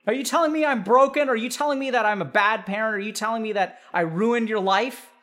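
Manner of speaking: mother fishing for reassurance; defensive parent. Acted.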